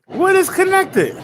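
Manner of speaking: drawn-out, high, exasperated question; slow, high, baffled complaint. Real, not acted. Streamer frustrated at game puzzle.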